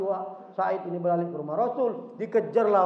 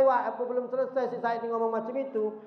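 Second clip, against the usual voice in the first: ordinary delivery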